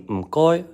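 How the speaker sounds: "Mkoy" said high and loud with a Cantonese tone; Cantonese m goi. Shown as a demonstration. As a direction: pitch "Mkoy" up high, a crisp Cantonese syllable pair. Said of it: "the Cantonese expression".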